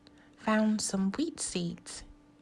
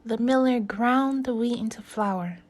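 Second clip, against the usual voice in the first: plain reading voice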